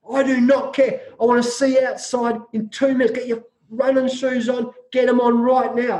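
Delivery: a retold coach laying down the law; stern coach orders. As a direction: bark orders at the hungover players. Coach orders hungover players.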